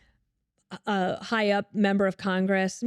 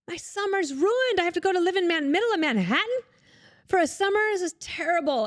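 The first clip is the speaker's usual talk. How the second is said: mock despair; 11 semitones higher than usual. Thinking about a summer in Manhattan, high whiny dismay. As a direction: wail about a ruined summer. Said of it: "And I thought".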